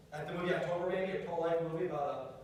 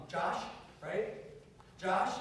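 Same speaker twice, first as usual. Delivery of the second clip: normal talking voice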